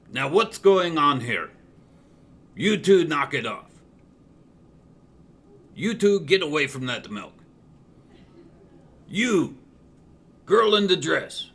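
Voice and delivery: loud, commanding police voice; stern cop. Acted. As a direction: bark orders to break it up. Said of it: "all of a sudden you hear".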